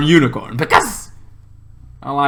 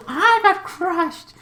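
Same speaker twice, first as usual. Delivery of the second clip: mock dramatic crushed; much slower than usual.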